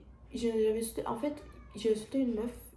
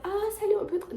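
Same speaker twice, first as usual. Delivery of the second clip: much higher, imagined hello to strangers; mock friendly greeting; 11 semitones higher, 3 dB louder than usual. Shy teen imagining approaching new classmates, mock-friendly high greeting. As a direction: act out an eager approach, pitched way up.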